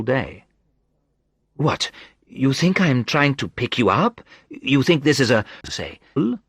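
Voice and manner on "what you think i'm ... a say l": higher, teasing woman's voice; lightly voiced teasing woman. A narrator's reading. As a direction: tease him in her voice. Narrator voices Sandra.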